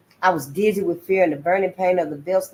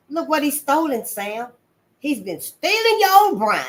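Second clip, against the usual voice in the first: high, loud outraged tattling; indignant accusation; 7 semitones higher, 4 dB louder than usual. Acted. Missus Bill showing Sam what the boy stole, high and indignant. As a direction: show him the proof, outraged. Mistress showing husband the drawer.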